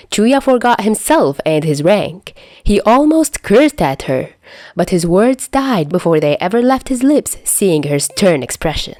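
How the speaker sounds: plain reading voice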